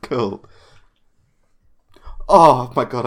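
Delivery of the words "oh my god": excited roll cheer. Real, not acted. Successful roll.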